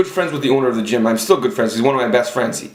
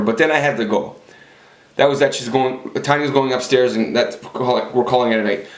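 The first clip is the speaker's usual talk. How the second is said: usual speaking voice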